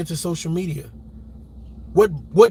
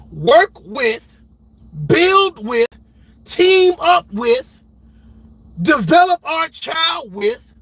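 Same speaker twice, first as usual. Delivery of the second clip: each phrase higher, loud and slow; building insistence, word by word; emphatic list; 7 semitones higher, 4 dB louder, much slower than usual. Real, not acted. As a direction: hammer every item home. Ranting list, slowing.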